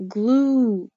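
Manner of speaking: Glue with a drawn-out "oo"; long U sound. Shown as a demonstration. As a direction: stretch the vowel in Glue. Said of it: "glue has the long sound".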